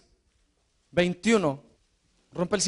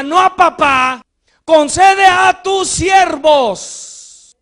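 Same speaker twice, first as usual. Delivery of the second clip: louder and higher with each line until a slow shouted climax; building shout; 6 semitones higher, 8 dB louder, slower than usual. Acted. Preacher crying out the prayer to the church, building to a shout. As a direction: hammer every no, building to the peak.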